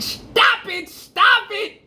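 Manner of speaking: high, loud pleading cry to stop a fight; retold frantic shout. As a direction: yell it like a mother begging them to stop. Mother pleading during the fight.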